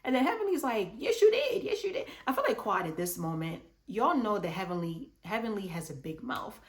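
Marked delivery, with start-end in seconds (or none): 1.0-2.1 high, loud insistent yes you did; insistent high retort. Retold or imitated. Heavenly shouts back at Quad, high and insistent. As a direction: insist like a friend caught lying.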